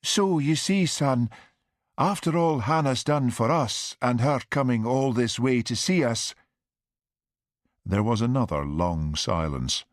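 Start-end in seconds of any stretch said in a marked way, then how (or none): 0.0-6.3 high, plaintive pleading; plaintive mother. A narrator's reading. Mother excusing aunt's visit to son, plaintive. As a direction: appeal to your son in a higher mother's voice. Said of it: "His mother's voice grew plaintive".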